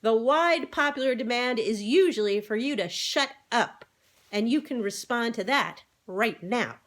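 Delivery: loud scolding; sharp hen retort; vigorous. A narrator's reading. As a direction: put him firmly in his place. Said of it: "interrupted Henrietta vigorously".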